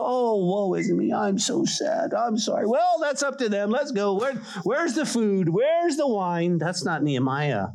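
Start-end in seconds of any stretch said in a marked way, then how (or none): 0.0-1.1 mock lament. Mock lament of uncaring man. 1.1-2.1 hushed, slow fake sorrow; mock sad whimper. Mock lament, whispered whine. 2.1-6.6 careless mock shrug. Careless man wanting feast.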